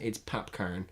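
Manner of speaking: "Popcaan" said as PAP-caan, not popcorn; the artist's name, "Popcaan", not "popcorn"; name pronounced carefully. Shown as a demonstration. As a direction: land on the second syllable of "Popcaan", caan not corn. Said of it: "I'm not saying popcorn in a Jamaican accent".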